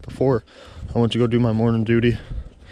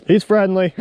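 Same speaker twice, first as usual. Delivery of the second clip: called out, higher and louder; calling out; 8 semitones higher, 5 dB louder than usual. Real, not acted. To a passerby about his dog, calling out loud. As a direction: reassure the passerby across the path.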